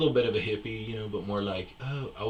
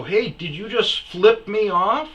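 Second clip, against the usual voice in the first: higher, louder, slow challenge; angry confrontation; 10 semitones higher, 9 dB louder, slower than usual. Retold or imitated. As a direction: call him out sharply. Confronting driver in trailer park.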